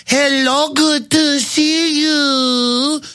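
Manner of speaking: high, drawn-out, patronizing greeting; condescending Rick Perry. Retold or imitated. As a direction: greet them loftily in a slow, high-pitched voice.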